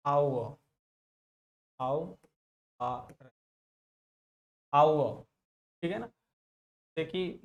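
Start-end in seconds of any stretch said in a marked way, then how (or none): 0.1-5.2 "आओ" as au-uh, no v or r; correct pronunciation. Shown as a demonstration. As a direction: sound out "आओ" slowly in parts, au, then uh. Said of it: "तो सही pronunciation होता".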